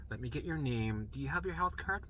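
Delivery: softly plodding intake questions; mildly slow, quiet clerk voice; slow, tired nurse. Retold or imitated. Nurse asking a regular patient for details, slow. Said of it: "And they're really slow, too".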